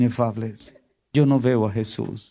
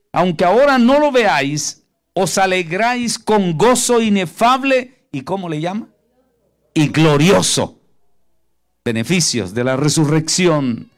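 fervent preaching; 5 semitones higher, 5 dB louder than usual. Acted. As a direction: proclaim each phrase to the congregation. Preaching the verse at full force.

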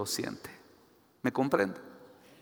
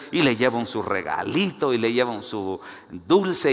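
ordinary talk

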